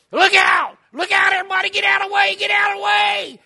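high, loud yelled warning at the top of the lungs; frantic warning holler. Retold or imitated. Ezra warning everyone at the store, loud high shout. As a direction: yell it to clear everyone out of the road. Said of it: "he started to holler at the top of his voice".

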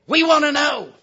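high, loud confrontation; storming demand. Acted. Ditch digger confronts his lazing cousin under the oak, storming mad. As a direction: demand it loudly, pitched well up. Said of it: "Ezra stormed out of that ditch".

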